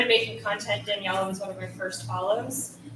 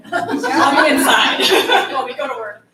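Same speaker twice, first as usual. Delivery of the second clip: laughing; 3 semitones higher, 10 dB louder than usual. Real, not acted. Laughs at grim statistic.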